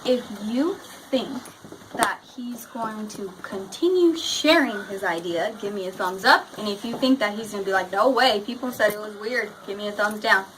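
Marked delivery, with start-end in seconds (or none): none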